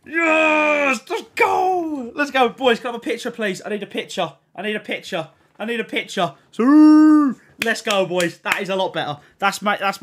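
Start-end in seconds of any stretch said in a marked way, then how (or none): 0.1-2.8 cracking, much higher, screaming yes; overjoyed shout. Real, not acted. Streamer celebrating a great pack with his boys, tearful joyful scream. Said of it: "I'll stop crying like a five-year-old". 6.5-8.4 much louder, higher shout; loud cheer. Real, not acted. Streamer hyping his viewers, loud cheer. Celebrating good pack.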